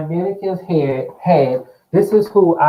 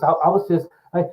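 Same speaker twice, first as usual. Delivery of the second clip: ordinary delivery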